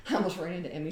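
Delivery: ordinary talk, nothing marked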